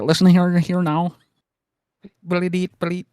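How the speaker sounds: loud, high mock Newfoundland gabble; Newfoundland accent. Showing friends how Newfoundlanders sound, exaggerated accent. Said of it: "They sound just like".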